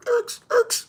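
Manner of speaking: high, loud squealing cry; imitated squeal. Dodging like Barry Sanders, a loud high squeal. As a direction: squeal like a startled animal.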